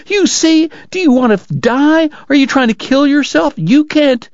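very high, frantic pleading; weeping and frantic. A narrator's reading. Kate to Doc after his coughing fit, frantic. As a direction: plead frantically at the top of your range. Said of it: "weeping and frantic".

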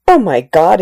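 a narrator's lightly dramatized gasp of horror; horrified exclamation. A narrator's reading. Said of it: "he exclaimed".

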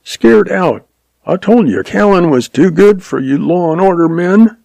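high-pitched taunting yelp; high, mocking kid's shout without much volume; yelping, shouting boy. A narrator's reading. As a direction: call out a jeer like a boy. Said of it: "Other boys behind him now shouted".

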